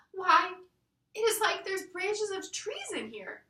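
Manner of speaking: high, loud burst of wonder; excited exclaim. Acted. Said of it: "exclaimed Lucy".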